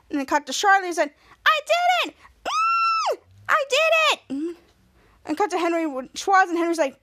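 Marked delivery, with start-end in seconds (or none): 1.5-4.6 very high acted celebration; excited squeal. Charlotte celebrating her success, high excited squealing. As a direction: squeal it out in triumph.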